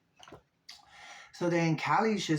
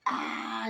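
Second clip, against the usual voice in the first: mock crowd yell; 10 semitones higher than usual. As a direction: imitate the crowd yelling at Gretchen. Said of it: "you hear everybody else yelling at Gretchen".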